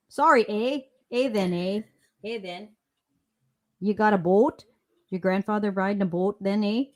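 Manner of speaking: playful Canadian "eh" voice, lightly done; Canadian tourist, mildly hammed-up Canadian accent; mock Canadian accent. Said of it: "Canadian tourist".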